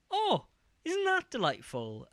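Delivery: high, posh-sounding put-on delight. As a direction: coo it in a high mock-genteel voice. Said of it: "and was like".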